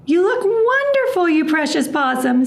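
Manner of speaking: high, doting grandmother voice; adoring praise. A narrator's reading. Grandma Poss admiring Hush, high and doting. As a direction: gush over her lovingly. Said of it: "said Grandma Poss".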